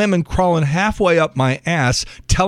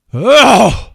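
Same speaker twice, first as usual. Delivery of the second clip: loud, drawn-out "ugh"; exasperated groan; 9 semitones higher, 7 dB louder than usual. Acted. Exasperated at the adoption argument.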